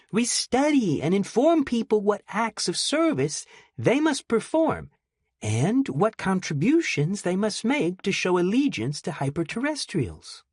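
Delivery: high, eager know-it-all lecture voice; prim lecturing researcher. Acted. Researcher lecturing the preacher, high and prim. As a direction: lecture him like a smug zealot.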